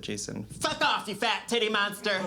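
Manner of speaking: unleash a comic tirade at him; mock outburst. Finally tells off husband.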